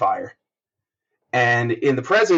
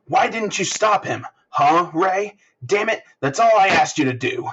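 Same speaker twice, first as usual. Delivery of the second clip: raised accusing shout at his wife; angry yelling father; 8 semitones higher than usual. Acted. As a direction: demand it like a furious father.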